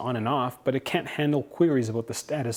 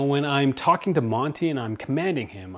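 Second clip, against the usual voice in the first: ordinary talk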